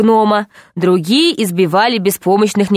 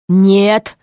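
usual speaking voice

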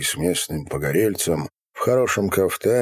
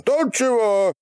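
very high, yelled question; louder yell. A narrator's reading. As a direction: yell it as if deafened. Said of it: "пуще будто оглохший заорал Ярыга". (истошный ор, будто оглох)

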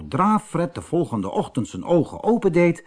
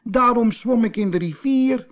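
put-on high, childlike mermaid voice; little mermaid; 10 semitones higher than usual. A narrator's reading. Little mermaid explains why she swam, high small voice.

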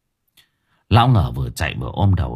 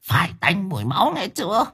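put-on high, hissing eerie old hag; ghoulish crone; 8 semitones higher, 7 dB quieter than usual. Grandmother demanding bloody raw meat, eerie and hissing.

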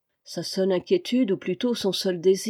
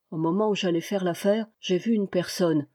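plain reading voice

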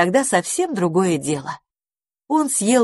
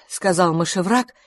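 usual speaking voice

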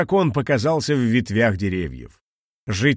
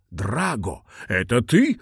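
surprised; slower than usual. Acted. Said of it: "удивлённо спросил он".

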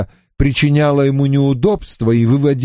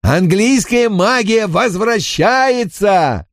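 high slow shout, "Английская" hammered; triumphant shout; 9 semitones higher, slower than usual. Acted. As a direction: roar "Английская магия возвращается" in triumph. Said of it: "крикнул Винкулюс".

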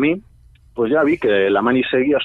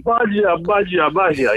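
smug cop voice; 7 semitones higher, much slower than usual. Retold or imitated. Secret cops approaching smugly.

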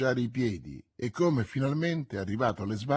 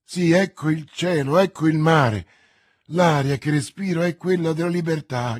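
louder, ringing joy at freedom; elated; 4 semitones higher, 8 dB louder than usual. A narrator's reading. Milady, freed from captivity, to Felton: loud elated rejoicing. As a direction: savour each word as if tasting free air.

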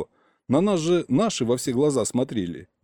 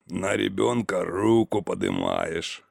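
low, slow, disapproving reproach; 5 semitones lower, slower than usual. A narrator's reading. Козлов reproaching uncle over the child, disapproving. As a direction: draw it out low with heavy disapproval. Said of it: "неодобрительно покачал головой Козлов".

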